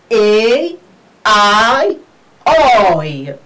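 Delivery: A, I, oi each stretched as "eyy, aay, ooy"; punched-out A, I, oi; diphthong drill. Shown as a demonstration. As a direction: model A, I, oi separately as long glides. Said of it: "we pronounce it as".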